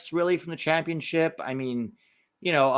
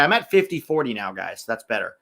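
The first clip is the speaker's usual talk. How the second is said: ordinary delivery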